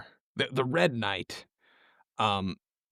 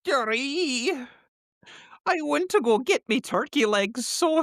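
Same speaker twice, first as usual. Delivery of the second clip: put-on high goofy Scottish accent like Shaggy; 13 semitones higher, 3 dB louder than usual. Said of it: "he says it in a Scottish accent, sounding vaguely of Shaggy".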